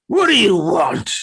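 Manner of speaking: put-on loud cranky old man; grumpy old man. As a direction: bark it like a woken grouch. Old man answers door.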